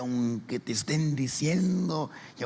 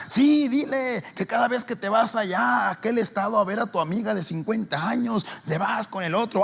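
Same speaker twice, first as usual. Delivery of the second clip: higher, louder taunting; accusing sister; 10 semitones higher, 6 dB louder than usual. Retold or imitated. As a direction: rub it in loudly.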